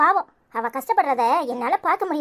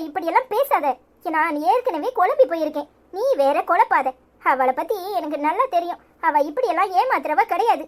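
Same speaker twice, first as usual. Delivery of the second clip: ordinary delivery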